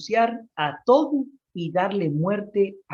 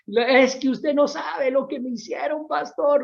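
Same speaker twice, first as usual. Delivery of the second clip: high, whiny mock complaint; whiny offended sister; 8 semitones higher than usual. Offended sister whining to the pastor, high and pleading. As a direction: play the aggrieved churchgoer, pitched up and pleading.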